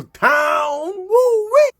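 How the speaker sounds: high, loud whooping cry; sign-off shout. Real, not acted. Host shouts his catchphrase at the sign-off, whooping. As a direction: belt out the show's catchphrase.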